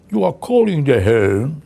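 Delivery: slow, stiff, put-on answering machine voice; stilted machine voice. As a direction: read it like a pompous recorded greeting. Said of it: "what does your answering machine say".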